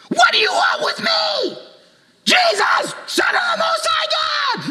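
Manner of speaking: very high, loud screeching demon cry; demon shout. Acted. Possessed man shrieking at Jesus, high screaming demon voice. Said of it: "he shouted at the top of his voice".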